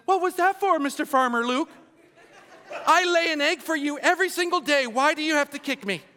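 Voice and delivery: high, indignant mock chicken; talking chicken voice. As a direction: pitch it up as a wronged chicken. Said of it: "the chicken turned around me and says".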